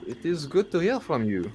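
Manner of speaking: put-on rising, self-admittedly rough French accent for Lou; bad French accent. GM voicing Lou's reply to Isabel, rough French accent. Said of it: "you'll have to forgive the really, really bad accent".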